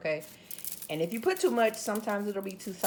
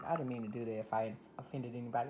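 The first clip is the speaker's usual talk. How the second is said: ordinary delivery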